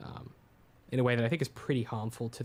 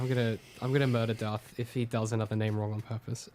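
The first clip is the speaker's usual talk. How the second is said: ordinary talk, nothing marked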